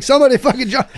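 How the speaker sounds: half-laughed mock plea mid-fight; laughing plea. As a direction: call for help while laughing. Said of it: "I'm like".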